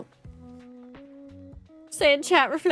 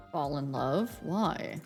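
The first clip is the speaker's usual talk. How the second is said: low, cool Reaper voice, lower pitch; Grim Reaper voice; 6 semitones lower, 7 dB quieter, slower than usual. Acted. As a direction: aloof death god, calm and low. Death's game lines voiced.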